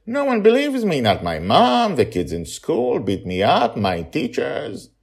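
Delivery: put-on high, loud child voice, aggrieved; a boy spilling out how nobody believes him; hurt little boy. Charlie complains, child voice.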